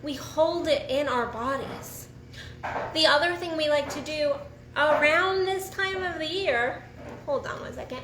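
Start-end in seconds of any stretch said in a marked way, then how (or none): none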